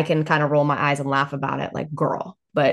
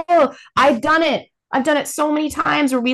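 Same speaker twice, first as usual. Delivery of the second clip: normal talking voice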